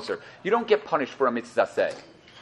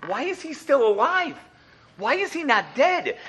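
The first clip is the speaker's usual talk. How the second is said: high, slow, baffled questions; puzzled; 11 semitones higher, slower than usual. Retold or imitated. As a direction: wonder aloud why he lived. People puzzled by Korach surviving.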